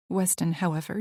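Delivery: plain reading voice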